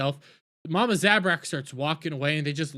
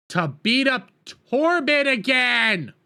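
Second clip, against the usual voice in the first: slow, louder outraged complaint; annoyed fan venting at the writers; indignant shout; 5 semitones higher, 4 dB louder, slower than usual. Real, not acted. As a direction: spell out the outrage slowly. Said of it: "complaining about the episode".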